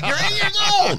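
very high mock scolding; outraged parent yell. Comic plays parent shouting at eight-year-old, outraged. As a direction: screech it in exasperated disbelief. Acted parent yelling at child.

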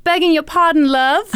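high, hammed-up British accent. British woman politely approaching Ralph, exaggerated British accent. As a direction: put on a chirpy British woman's voice. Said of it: "you doing a British accent".

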